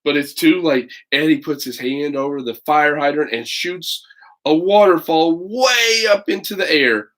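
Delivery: put-on lively read-aloud for children; story narration. A narrator's reading. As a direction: tell it like a picture book to kids. Reads picture book aloud.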